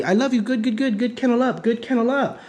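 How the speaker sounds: high gushing praise voice; excited puppy praise; mock praise to puppy. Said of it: "you're telling puppy".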